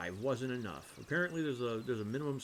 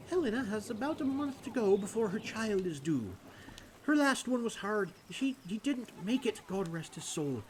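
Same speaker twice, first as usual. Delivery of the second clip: higher-pitched game character voice; concerned villager; 10 semitones higher, 4 dB louder than usual. Acted. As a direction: lift the voice for a worried townsperson.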